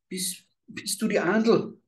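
put-on high, hesitant little girl's voice, stumbling in fear; a scared child asking a stranger; frightened child. A narrator's reading. Lost girl asks the old woman at the door, frightened. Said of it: "fragte ganz ängstig".

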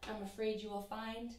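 usual speaking voice

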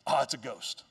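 low, hushed, understated mock alarm; mock fearful reaction. Preacher mimicking the disciples' reaction after all they saw, understated mock alarm. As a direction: imitate them softly, pitched well down.

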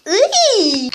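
drawn-out "eww" noise; acted cry of disgust; disgusted squeal. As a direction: recoil with a squealed ugh. Disgust at wet floor.

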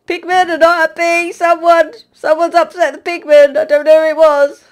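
high, loud guilty announcement; frantic announcement. Real, not acted. Warning the server about the pigmen, high and loud. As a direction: blurt it out innocently.

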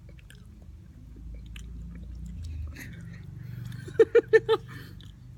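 long wheezy silent laugh; breathless laughter. Real, not acted. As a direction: laugh until no sound comes.

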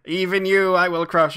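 booming threat. Retold or imitated. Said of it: "just like".

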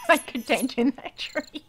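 loud, breaking into laughter; laughing. Real, not acted. Player facing a giant tree, laughing. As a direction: laugh through the words, then keep laughing.

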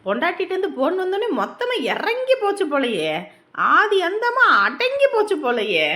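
mocking tease. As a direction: needle your henpecked friend. Teasing friend cowed by wife's call.

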